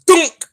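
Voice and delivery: loud mock thud; a short sharp bonk sound; thud imitation. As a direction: say it like a head hitting the ceiling. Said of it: "all I heard was".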